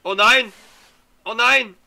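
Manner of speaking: high loud desperate wail; panicking gamer. Real, not acted. Being attacked, losing item.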